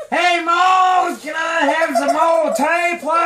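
hammed-up hillbilly shout. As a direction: call out like a backwoods hillbilly asking for tea. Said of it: "We can have a hillbilly tea party".

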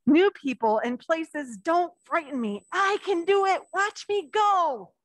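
slow, lifted storybook voice; reading to children. Acted. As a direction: draw the little ones into the story. Reads picture book to children.

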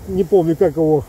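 usual speaking voice

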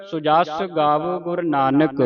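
ordinary talk

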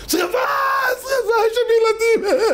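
very high, frantic acted shriek; panicked fire scream. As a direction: scream the alarm to save children. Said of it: "מה אתה צועק". (צרחת בהלה גבוהה)

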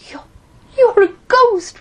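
put-on frightened stammering boy. A narrator's reading.

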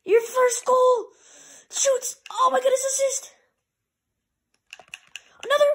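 very high, loud, stretched shouting; frantic play-by-play. Real, not acted. Gamer calling a near goal, frantic high shouting. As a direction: yell the chance at the top of your range. Calls a scoring chance.

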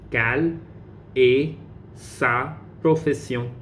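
quelle, pause, then est sa profession drawn out slowly; slow, deliberate model. Shown as a demonstration. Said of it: "teacher repeats slowly".

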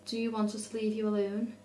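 ordinary talk, nothing marked

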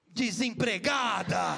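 low, slow shouted insult; hammed-up low slow yelled put-down; slow mock insult. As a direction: drag the word out like a cruel final blow. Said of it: "o que mais?".

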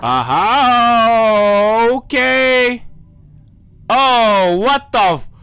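loud, high shocked exclamation; startled outburst. Real, not acted. Gamer startled as something opens, loud shocked outburst. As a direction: blurt out in shock.

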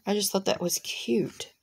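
soft unvoiced whisper of delight; whispered aside. Real, not acted. Crafter whispering to viewers about the cute car. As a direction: whisper it like a little secret.

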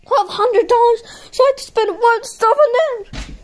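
high shocked question. Pretend. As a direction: balk at the price. Shocked at price.

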